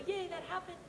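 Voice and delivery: high, soft mock cheer; a small high-pitched yay with a laugh; retold cheer. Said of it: "read the script and I go".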